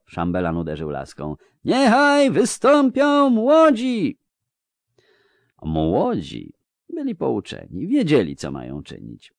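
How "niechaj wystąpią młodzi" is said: high, loud, slow herald's cry; drawn-out shouted proclamation; ceremonial summons. A narrator's reading. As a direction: call them forward across the courtyard. Chamberlain summons the young.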